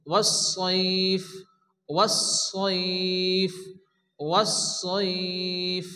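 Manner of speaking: ওয়াসসাইফ slowly with doubled স and held ই; drawn-out. Shown as a demonstration. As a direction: stretch ওয়াসসাইফ one alif. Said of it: "এক আলিফ পরিমাণ টান দিয়ে আমরা পড়ব".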